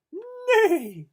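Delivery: whinny high and loud; loud high neigh. Acted. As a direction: neigh like a horse. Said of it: "said the horse".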